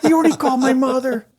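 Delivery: high, loud tattling; hammed-up telling on a friend; mock outrage. Friend telling the household, mock indignant. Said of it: "Then he tells everybody".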